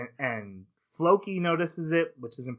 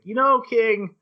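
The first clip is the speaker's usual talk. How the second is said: higher, louder, faux-innocent question; mock innocent; 6 semitones higher, 4 dB louder than usual. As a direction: play dumb as you needle the king. Askeladd slyly questioning the king.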